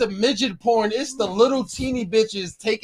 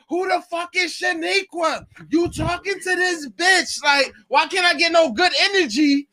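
mock jealous girlfriend; 8 semitones higher than usual. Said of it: "and you like".

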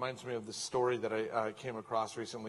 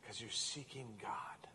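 hushed whisper; 3 semitones lower, 10 dB quieter, slower than usual. Acted. Pastor voices God to the congregation in a slow whisper. Pastor voicing God's tender words.

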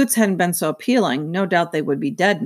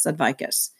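ordinary delivery

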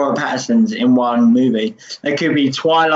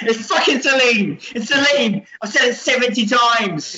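exasperated mock outburst; 7 semitones higher than usual. Lady Blade insists on her name.

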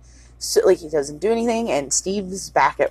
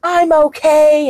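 mock angry tirade; 6 semitones higher, 4 dB louder than usual. Retold Annie tirade.

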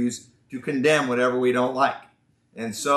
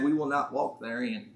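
softer, southern-accented mock quote; 3 semitones lower, 7 dB quieter than usual. Preacher quoting the stubborn group to his congregation, southern accent. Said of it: "I talk with a southern accent".

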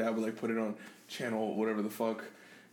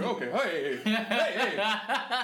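higher, laughing nervous repeats; mock placating; 7 semitones higher, much slower than usual. Son calming his snapping dad, mock placating. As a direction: play backing someone down in alarm.

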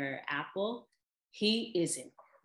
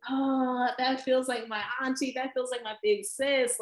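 gushing; 8 semitones higher, 5 dB louder than usual. Retold or imitated. Gushing about Michelle as family.

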